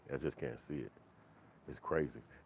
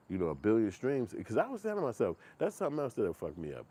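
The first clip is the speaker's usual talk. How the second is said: normal talking voice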